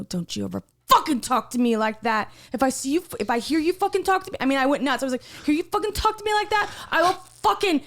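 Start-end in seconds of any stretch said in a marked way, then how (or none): 0.0-4.4 rising, fast, threatening rant; furious warning. Retold or imitated. You just gave me the eyes that you gave her. 5.4-7.8 high, fast, furious threat; furious outburst. Retold or imitated. Angry [name].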